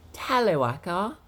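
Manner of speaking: high, swooping put-on voice; off-target Tyler impression. Shown as a demonstration. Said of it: "he doesn't speak like that".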